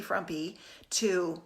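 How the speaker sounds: ordinary talk, nothing marked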